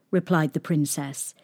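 usual speaking voice

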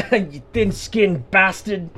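slow, high, loud mocking jeer; mocking exaggerated jeer. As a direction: drag it out in a high mock sneer. Mocking echo of insult.

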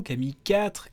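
ordinary delivery

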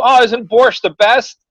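forced small talk with the landlady; fake nice. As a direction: schmooze her, overly pleasant. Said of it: "I'm trying to fucking just be nice".

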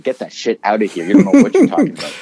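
loud mock shout; retold shout. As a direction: snap it out loud and fast. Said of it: "I said".

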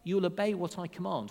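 usual speaking voice

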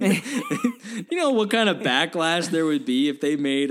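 talking through laughter, high and slow; real laughter in the voice; laughing. Real, not acted. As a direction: push the words out while cracking up. Cracking up over the joke.

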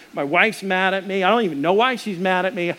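usual speaking voice

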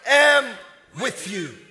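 slow, loud, emphatic pulpit shout; fervent preaching. Real, not acted. Preacher ordering the congregation to stop being scared, shouting emphatically. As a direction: build to a stretched, high scriptural cry.

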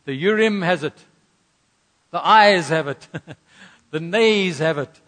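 loud announcer call, breaking into a laugh; proclaiming verdict. Acted. Aaron announcing the verdict to the people, loud and playful. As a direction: proclaim it to the whole assembly.

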